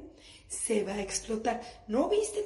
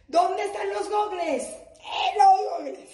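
frantic mom yell; 7 semitones higher, 7 dB louder, slower than usual. Retold or imitated. Mom frantically packing beach things.

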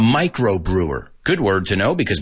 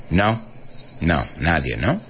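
ordinary talk, nothing marked

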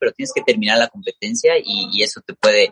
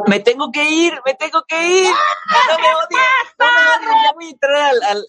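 much higher, playful shout goodbye; high-pitched calling out. Real, not acted. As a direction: squeal it out cheerfully. Calling out while leaving.

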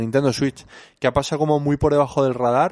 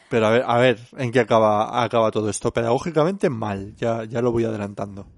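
ordinary talk, nothing marked

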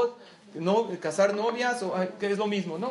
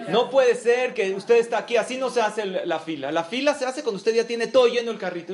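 loud, indignant telling-off; raised voice of an irate shopper; retold angry scolding; 3 semitones higher, 5 dB louder than usual. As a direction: scold them for breaking the rules of the line. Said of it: "le empezó a gritar".

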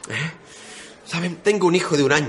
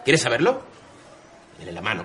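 normal talking voice

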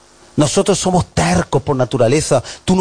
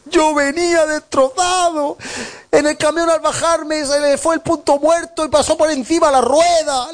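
much higher, pitiful complaint; mock wounded husband; 12 semitones higher than usual. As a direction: play the husband telling his sad side, higher. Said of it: "la otra versión".

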